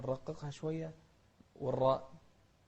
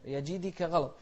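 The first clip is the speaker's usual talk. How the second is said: usual speaking voice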